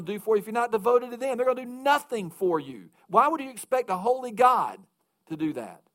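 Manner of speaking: rising pulpit fervor, pressing the congregation; preaching at full force. Acted. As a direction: hammer the call home from the pulpit. Preaching the call to devotion.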